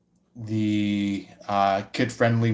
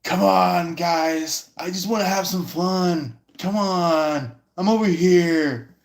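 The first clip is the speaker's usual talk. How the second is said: higher, whiny come-on; pleading guy; 6 semitones higher than usual. Retold or imitated. Rejected guy begging others for fun, whiny. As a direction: beg the crowd for attention. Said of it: "people going like".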